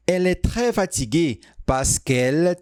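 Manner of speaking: ordinary delivery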